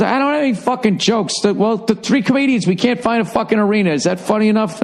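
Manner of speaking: high-pitched mock exasperation; exasperated retort. Lost comedian asked by guards for a joke, exasperated.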